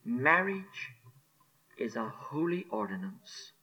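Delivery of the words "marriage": usual speaking voice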